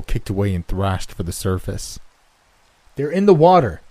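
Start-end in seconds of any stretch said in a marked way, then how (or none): 2.9-3.8 high, loud alarmed yell; shouted warning. A narrator's reading. Swimmer warning friends of things under the water, shouting. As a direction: yell a frantic warning to the group. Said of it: "I shouted".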